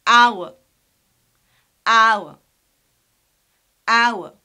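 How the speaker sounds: "our" loud, as "ow-uh" without R; triphthong pronunciation. Shown as a demonstration. Teacher showing three vowels, "our" as "ow-uh". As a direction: glide "our" from ow to short schwa. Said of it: "We pronounce it as".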